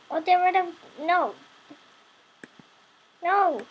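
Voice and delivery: real upset shout at lag; frustrated outburst. Real, not acted. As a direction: yell at the laggy game. Game lags, frustrated outburst.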